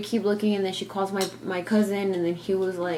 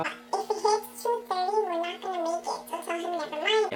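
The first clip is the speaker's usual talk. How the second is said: much higher put-on mom voice; mimicking mom; 12 semitones higher than usual. Narrator imitates her mom asking the driver, high-pitched.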